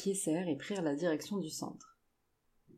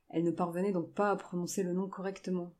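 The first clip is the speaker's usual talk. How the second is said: usual speaking voice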